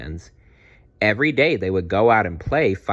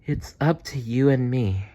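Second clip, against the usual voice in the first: sleepy; 4 semitones higher than usual. Acted. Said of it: "yawned Maya".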